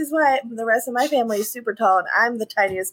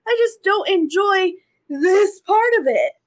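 near tears; 7 semitones higher, 4 dB louder than usual. Real, not acted. Upset over being called unmaternal.

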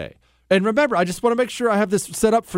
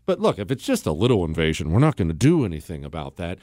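mock Biden voice, suddenly much lower, soft and quick; mock Biden mumble; 3 dB quieter, faster than usual. Host mocks Biden talking to Putin, lower quick mumble. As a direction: mumble the weak part lower and faster, imitating Biden. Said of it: "basically saying".

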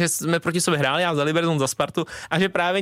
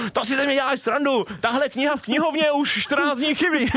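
higher-pitched put-on complaint; mock protest; 8 semitones higher than usual. Player pretending to curse a referee in Czech, mock protest. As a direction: play at berating someone, pitched up.

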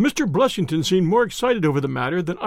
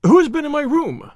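high, loud burst of alarm; panicked cry; 9 semitones higher, 6 dB louder than usual. A narrator's reading. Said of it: "he cried".

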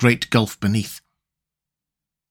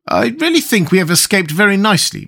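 proud; 6 semitones higher than usual. Acted. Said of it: "in a tone of pride".

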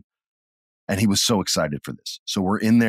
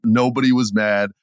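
ordinary talk

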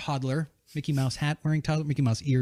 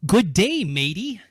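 loud, high-pitched Australian greeting; Australian accent; 5 semitones higher, 8 dB louder, slower than usual. Shown as a demonstration. Host showing off to the guest, hammy Australian accent. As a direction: belt out a put-on Australian hello. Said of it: "Australian. Ready?".

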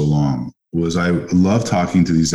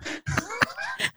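a real, high burst of laughter, much softer; high-pitched laugh; 12 dB quieter than usual. Real, not acted. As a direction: laugh at your own self-deprecating joke. Laughs at his own joke.

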